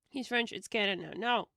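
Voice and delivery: ordinary talk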